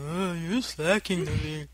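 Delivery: low, soft imitation of Lagwood; Lagwood impression. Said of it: "just the Lagwood impression".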